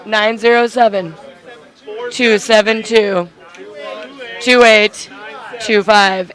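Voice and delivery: slow, loud, spaced-out digits. Real, not acted. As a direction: read each number slowly and loudly. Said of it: "Say it slower".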